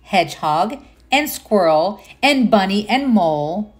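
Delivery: gentle storybook narration; reading to children. Acted. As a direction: read it like bedtime to little ones. Reads picture book aloud.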